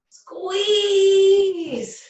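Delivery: high, loud sung-out squeeze; cheering. Acted.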